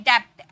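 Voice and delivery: "डेप्थ" with a p/b before the t; sounded B. Shown as a demonstration. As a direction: say "डेप्थ" as dept, as everyone does. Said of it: "सब बोलते हैं डेप्थ".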